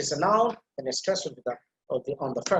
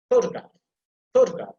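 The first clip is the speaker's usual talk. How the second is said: Photograph with PHO punched higher and louder; emphasis on first syllable; 6 semitones higher, 5 dB louder than usual. Shown as a demonstration. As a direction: punch PHO, drop quickly through the rest of Photograph. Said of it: "first syllable that I'm putting emphasis".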